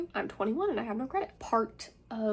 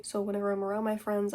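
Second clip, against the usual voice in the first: ordinary delivery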